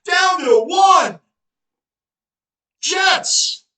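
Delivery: loud, high play call; excited cheering. Real, not acted. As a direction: call it like a fan. Cheering run to goal line.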